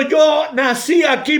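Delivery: ordinary delivery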